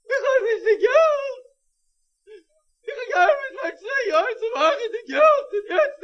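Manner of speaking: high, wailing lament with sobs; despairing cry. Acted. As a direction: cry out in despair over the lost money. Pesach despairs over lost money. (verzweifelt weinend klagen)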